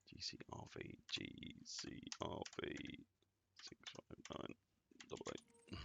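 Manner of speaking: barely audible breathy whisper of the code. Real, not acted. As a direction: whisper each letter and number while typing. Repeats code while typing it.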